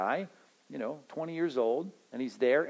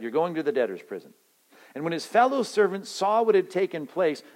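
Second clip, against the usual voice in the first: ordinary talk, nothing marked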